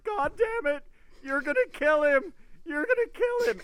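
high, loud furious yell; mad friend yelling. Retold or imitated. Friend on the phone screaming about the book. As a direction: shriek it like an outraged reader. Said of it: "He was so mad".

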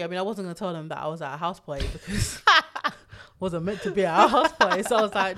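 laughing through the confession; giggling, sheepish admission. Real, not acted. Laughs while confessing house party.

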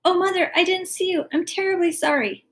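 put-on high-pitched child's apology; hurriedly contrite, voice lifted; sorry. A narrator's reading. Daughter who threw pepper in mother's eye, apologetic. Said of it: "instantly sorry".

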